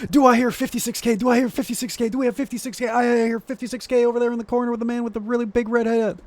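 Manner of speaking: high, fast auctioneer patter. Pretend. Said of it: "You sound like an auctioneer".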